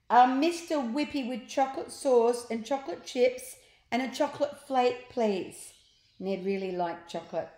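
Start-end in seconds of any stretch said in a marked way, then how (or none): 0.1-5.7 eager order. Acted.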